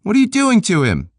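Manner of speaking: high, loud startled woman's voice; Peg's voice. Acted. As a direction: voice the young woman, alarmed. Narrator voices Peg.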